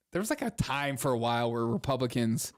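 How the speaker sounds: ordinary delivery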